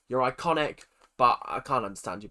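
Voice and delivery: usual speaking voice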